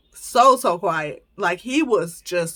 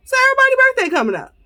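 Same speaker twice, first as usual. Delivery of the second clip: much higher, louder and fast overwhelmed burst; excited exasperation; 4 dB louder, much faster than usual. Real, not acted. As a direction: rattle it off high like it's all too much. Overwhelmed by birthdays.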